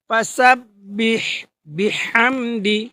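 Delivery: ফাসাব্বিহ বিহামদি slow, each syllable drawn out, breathy হ; correct Arabic pronunciation. Shown as a demonstration. Teacher models the right way: ফাসাব্বিহ বিহামদি drawn out. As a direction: recite ফাসাব্বিহ বিহামদি slowly and carefully. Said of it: "এই তফাৎটা বুঝতে পারছেন".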